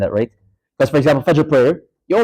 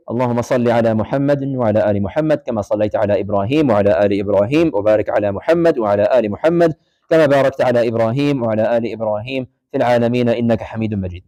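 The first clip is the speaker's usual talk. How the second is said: rapid, lower prayer recitation; fast recitation; 4 semitones lower, much faster than usual. Shown as a demonstration. Teacher showing students the tashahhud, fast recitation. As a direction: rattle off the Arabic like daily prayer. Recites salat Ibrahim as demo.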